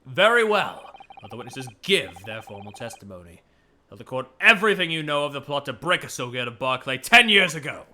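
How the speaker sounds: formal courtroom order, lightly voiced; commanding judge. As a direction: address the witnesses from the bench with emphatic bursts.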